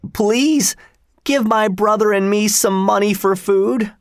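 high, pleading feigned suffering; groaning in pain. A narrator's reading. Hugo feigns illness to beg money, high pained plea. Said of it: "Hugo groaned in pain".

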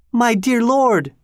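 high, drawn-out cry of delight; joyful cry. A narrator's reading. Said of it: "the sorceress cried out joyfully".

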